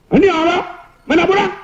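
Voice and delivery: high-pitched, curt irritated questions; angry. Acted. Angry principal demands the leave form, high irritated voice. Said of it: "kalau orang marah".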